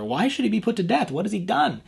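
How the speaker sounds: high, loud desperate protest; pleading outcry. Acted. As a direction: cry out in defence of a friend. Jonathan pleads with angry father.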